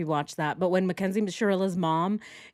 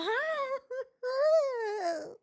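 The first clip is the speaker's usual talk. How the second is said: long high mock wail; drawn-out badly faked sob; fake sobbing; 3 dB quieter than usual. Commentator imitates Nicole crying, hammed-up fake sob. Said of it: "And here's Nicole".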